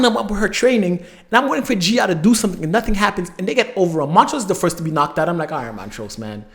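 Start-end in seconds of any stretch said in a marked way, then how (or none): 5.5-6.4 low, soft, deflated reaction; quietly unimpressed; let-down resignation. Retold or imitated. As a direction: drop the voice in disappointment. Acts out disappointed reaction to Montrose.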